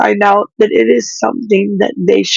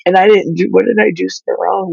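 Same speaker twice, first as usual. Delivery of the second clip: ordinary talk, nothing marked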